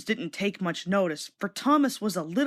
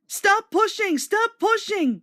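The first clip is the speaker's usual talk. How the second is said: hissed; 11 semitones higher, 4 dB louder, slower than usual. A narrator's reading. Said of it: "he hissed".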